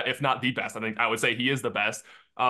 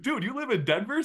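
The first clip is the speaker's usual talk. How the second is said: very high, incredulous retold question; amazed disbelief; 12 semitones higher than usual. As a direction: re-enact your astonishment with the pitch way up. Retold amazed message to a friend.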